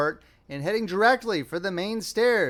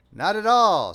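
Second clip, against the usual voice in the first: louder, higher offended denial; mildly indignant; 5 semitones higher, 8 dB louder, slower than usual. A narrator's reading. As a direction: protest with a touch of indignation. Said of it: "said Perry indignantly".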